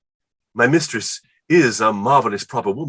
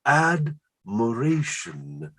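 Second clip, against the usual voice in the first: admiration drawn out very slowly and higher; savoring the word; 6 semitones higher, much slower than usual. Shown as a demonstration. Musing on her word, drawn out slowly. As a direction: stretch out admiration lovingly. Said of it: "in that one word, admiration".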